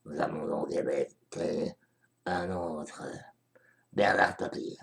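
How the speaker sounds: soft breathy ASMR whisper. Pretend. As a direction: whisper close to the mic, gently. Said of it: "notre A S M R".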